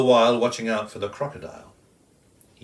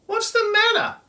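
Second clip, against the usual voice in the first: high loud bird voice asking; worried kookaburra; 5 dB louder than usual. Acted. Kookaburra asking the crying wombat, high concern. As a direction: ask in a high birdy voice.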